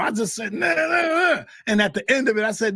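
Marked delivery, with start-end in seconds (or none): 0.5-1.4 heated refusal. Retold or imitated. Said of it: "I just went in on him".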